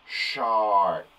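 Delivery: teacher blending shark; blended word. Shown as a demonstration. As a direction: model the whole word after segmenting. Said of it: "How many sounds".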